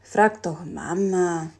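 slow, sighing drowsy dad; dad sighing from his warm stone, barely awake; sleepy sigh. Acted. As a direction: sigh it out half asleep. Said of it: "seufzte Jelon leicht verschlafen".

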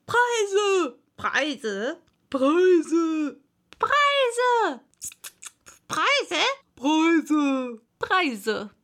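loud calls at jumping pitches; clamouring crowd. A narrator's reading. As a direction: shout it as many different voices in turn. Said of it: "Alle durcheinander rufend".

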